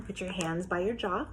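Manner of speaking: ordinary talk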